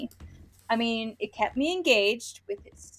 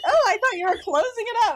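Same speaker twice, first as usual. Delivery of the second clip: mock surprise; 12 semitones higher, 7 dB louder, faster than usual. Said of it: "I'm like".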